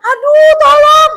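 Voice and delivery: high, loud, slow mock cry; crying for help. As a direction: shout for help over the wind. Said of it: "saya berteriak".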